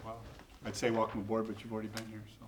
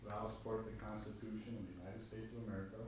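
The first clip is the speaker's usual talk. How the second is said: quiet prompting; 11 dB quieter, faster than usual. Real, not acted. Feeding oath lines to swear-in.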